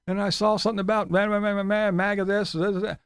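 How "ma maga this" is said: ordinary talk, nothing marked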